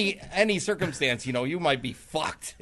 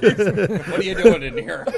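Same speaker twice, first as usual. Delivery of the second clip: laughing, slow and louder; 6 semitones higher, 8 dB louder, slower than usual. Pretend. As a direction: laugh through it. Mock scolding a farter, laughing.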